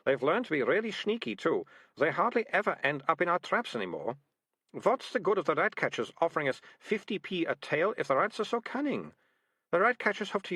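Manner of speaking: put-on a touch of a sharp young girl; girl complaining about cunning rats; Malicia's voice.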